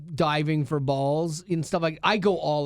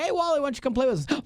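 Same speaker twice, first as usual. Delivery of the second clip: cool guys inviting; 10 semitones higher, much faster than usual. Retold or imitated.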